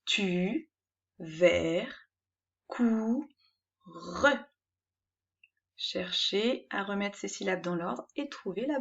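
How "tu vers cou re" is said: "Tu, vers, cou, re" said as separate beats with pauses; "Tu, vers, cou, re" cut into syllables. Shown as a demonstration. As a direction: model each syllable of "Tu, vers, cou, re" on its own. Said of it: "Je répète".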